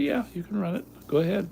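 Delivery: lightly acted, high-pitched okay; easygoing consent. His own quoted reply to agent.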